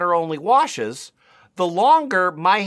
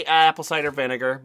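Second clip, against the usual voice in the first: ordinary delivery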